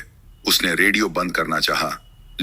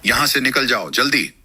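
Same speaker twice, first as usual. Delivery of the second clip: higher-pitched, understated warning; urgent warning; 6 semitones higher than usual. A narrator's reading. Radio voice warning the lone driver to leave, urgent warning. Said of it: "आवाज ने चेतावनी दी".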